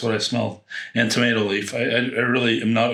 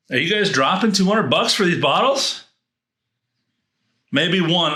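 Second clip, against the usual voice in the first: incredulous question; 8 semitones higher, 4 dB louder than usual. Real, not acted. Asking viewers about prices.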